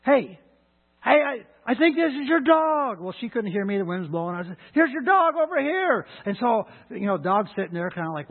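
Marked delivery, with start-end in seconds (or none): 0.0-3.0 louder, higher call. Retold or imitated. Jogger shouting across the lake to the dog's owner, loud call. 4.7-6.1 high, loud call. Retold or imitated. Jogger shouting to the woman over the wind, loud call.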